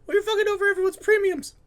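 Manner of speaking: high, loud indignant yell; shouted argument. Real, not acted. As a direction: yell the accusation at your friend. Heated mock argument over banks.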